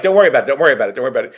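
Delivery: rapid-fire brush-off, starting high and loud, easing down; pushy Italian mother. Retold or imitated. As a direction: imitate a mother waving off all objections. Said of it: "being Italian and a mother".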